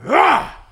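sudden high loud yell, mock fierce; a mock war cry, high and loud; retold battle cry. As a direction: let out a hammed-up fierce shout as she rips it open. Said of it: "she just goes".

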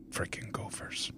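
breathy muttered grumble; whispered curse. Real, not acted. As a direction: mutter it under your breath.

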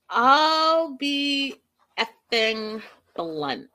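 a put-on dad, dragging out the last words; mock-stern father warning; blunt stern dad. Mike's blunt reaction, quoted.

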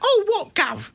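high-pitched protesting prisoner; mock London prisoner. Man mimicking a prisoner answering the warden, mock protest. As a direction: whine back at the warden in mockery. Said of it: "warden comes up and goes".

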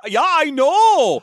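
put-on loud, high, drawn-out German accent. Actor agreeing his accent is terrible, loud comic German accent. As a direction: belt it out in hammy German. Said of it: "try out my German accent".

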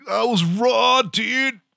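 high, drawn-out surfer-dude exclamation; eighties BMX movie hero. Shown as a demonstration. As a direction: overdo it like a teen movie hero. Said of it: "like the hero of an eighties B M X movie".

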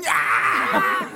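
high shouted cheer, laughing; whooping crowd imitation; mock crowd cheer. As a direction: roar it like fans thrilled by a throw-in.

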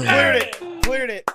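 high, loud victory shout; excited cheer. Real, not acted. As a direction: celebrate the win loudly. Team clears the board, cheering.

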